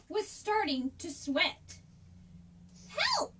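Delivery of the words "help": very high panicked squeak. A narrator's reading. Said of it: "he squeaked".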